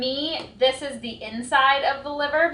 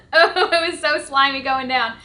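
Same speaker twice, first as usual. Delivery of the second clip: laughing, louder, grossed out; nervous laugh; 3 semitones higher, 6 dB louder than usual. Real, not acted. Vlogger just swallowed raw liver, laughing. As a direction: giggle through the disgust.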